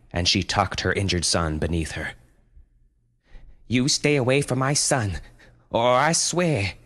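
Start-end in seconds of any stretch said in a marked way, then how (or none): 3.7-6.7 protective mother. A narrator's reading. Mother defends son.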